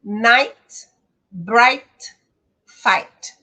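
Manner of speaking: "Night", "bright", "fight" loud, each ending with a falling tune on ai; English pronunciation model. Shown as a demonstration. Teacher modelling English pronunciation for students, loud and careful. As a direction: say "Night", "bright", "fight" as crisp one-syllable words. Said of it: "T no final".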